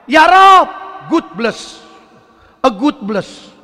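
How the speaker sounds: tiruan doa bule dengan logat Inggris patah-patah; doa orang bule. Retold or imitated. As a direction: meniru mualaf bule berdoa, dibuat-buat. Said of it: "Orang bule baca doa". (logat bule yang dilebih-lebihkan)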